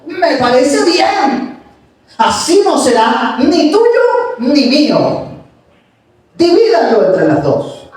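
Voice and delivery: slow, callous and unbothered; cold indifference. Acted. False mother coolly agreeing that the baby be cut in two, slow and callous. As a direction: shrug off the child's fate. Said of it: "la otra mujer dijo".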